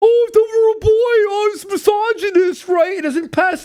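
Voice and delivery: mock-shocked shout about the film's plot; mock outrage. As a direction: shriek it like a scandalized fan.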